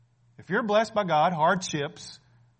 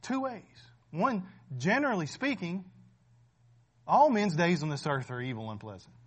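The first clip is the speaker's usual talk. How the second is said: normal talking voice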